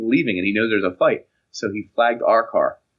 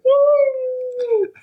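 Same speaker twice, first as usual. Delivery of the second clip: very high, loud party woo; drunk whoop; 4 dB louder than usual. Retold or imitated. Shirtless student cheering at the cop, very high loud whoop. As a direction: whoop like a drunk college kid. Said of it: "I was like".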